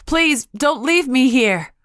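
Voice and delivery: slow, high, loud pleading scream; desperate scream. A narrator's reading. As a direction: wail it out in terror. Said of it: "I screamed".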